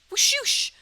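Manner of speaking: high, loud spoken whoosh; vanishing whoosh. Acted. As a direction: make a vanishing sound effect. Imitates the Colonel vanishing.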